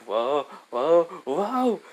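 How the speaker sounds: slipping and losing balance; alarmed whoa. Retold or imitated. Hermes falling into the water, rising alarmed whoas. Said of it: "Hermes is like".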